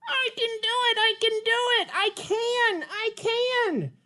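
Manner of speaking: high, bright puppet character, eager; Petrie puppet voice. Acted. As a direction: play the little pterodactyl, keen to try.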